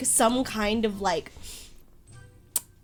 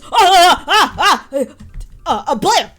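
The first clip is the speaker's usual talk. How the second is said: startled cries, louder and higher; flustered yelps; 7 semitones higher, 11 dB louder than usual. Acted. Archivist fighting off rats, yelping and flustered. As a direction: yelp in flustered panic. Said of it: "He goes".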